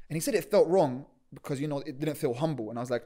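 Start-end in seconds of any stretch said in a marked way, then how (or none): none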